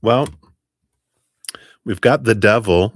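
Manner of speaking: ordinary talk, nothing marked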